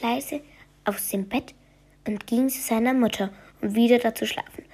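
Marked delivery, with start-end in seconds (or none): none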